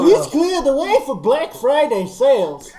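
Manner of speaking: mock Bane announcing a store sale; Bane impression. Podcaster hamming up a laughing Bane for friends. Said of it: "Attention, robot shoppers!".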